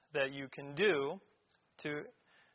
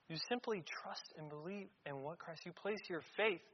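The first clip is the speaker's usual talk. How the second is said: ordinary talk, nothing marked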